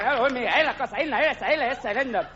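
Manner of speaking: high mock Spanish bullfighter stammer; Spanish accent. Bullfighter fumbling for words, high mock Spanish accent. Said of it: "What are you gonna say?".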